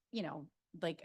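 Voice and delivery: usual speaking voice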